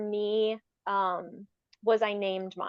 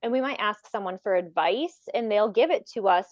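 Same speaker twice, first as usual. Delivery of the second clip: ordinary talk, nothing marked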